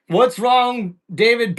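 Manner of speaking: ordinary talk, nothing marked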